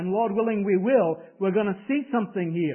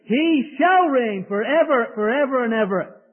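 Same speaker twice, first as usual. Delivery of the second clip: exultant proclamation; 6 semitones higher, 7 dB louder than usual. Real, not acted. Preacher quoting Handel's Messiah to the congregation, exultant and loud. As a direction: proclaim it triumphantly, stretching the words out.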